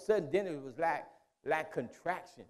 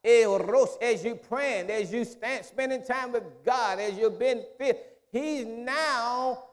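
high, loud, rolling preacher's cadence; full-force preaching; 7 semitones higher, 7 dB louder, slower than usual. Acted. Preacher pressing the church on the Spirit, full-force preaching.

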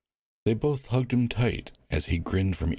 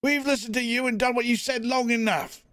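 much higher, defiant voice; defiant crowd member. Acted. Crowd member defying the warden, defiant. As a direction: throw it back at him.

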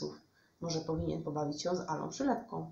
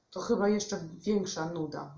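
ordinary delivery

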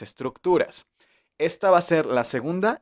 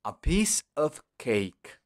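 slow model sentence; 2 semitones higher, 4 dB quieter, much slower than usual. Shown as a demonstration. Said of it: "¿te acuerdas?".

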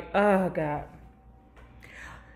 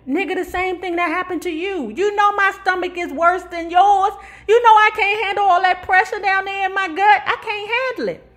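wife's excuse; 6 semitones higher than usual. Retold or imitated. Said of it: "She was like".

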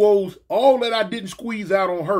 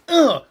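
loud, raised grunt; acted impact grunt; 5 semitones higher, 5 dB louder than usual. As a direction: grunt as if kneed. Imitates being kneed.

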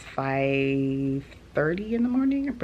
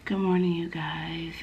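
ordinary talk, nothing marked

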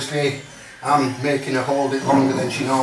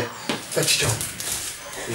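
hushed slow command; 3 semitones lower, 8 dB quieter, much slower than usual. Real, not acted. Quiet command to the dog.

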